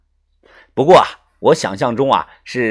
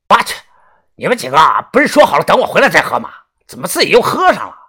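calling out, annoyed; 3 semitones higher, 5 dB louder, much faster than usual. Acted. Returning guard calling out.

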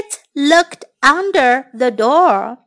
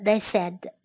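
usual speaking voice

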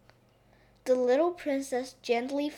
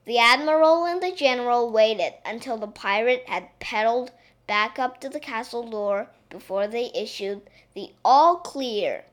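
ordinary delivery, nothing marked

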